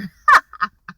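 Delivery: quick amused burst of laughter; short laugh. Real, not acted. Vlogger laughing at the wink, short laugh. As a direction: let out one short laugh.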